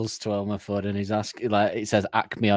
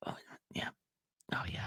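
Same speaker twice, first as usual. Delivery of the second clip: whispered, much softer oh yeah; breathless whisper; 19 dB quieter, faster than usual. Acted. Man seeing her in the dark, awed whisper. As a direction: breathe it out in awe.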